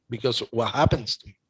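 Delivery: ordinary talk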